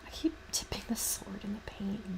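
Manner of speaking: whispered aside to herself. Real, not acted. Painting alone, grumbling to herself in a whisper. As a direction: breathe it out under your breath, barely voiced. Muttering over painting mistake.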